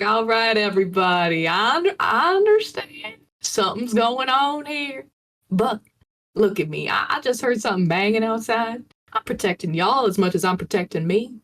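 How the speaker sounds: calm the room in a fake Southern voice; easygoing fake Southern peacemaker; Southern accent. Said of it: "I put on a southern voice".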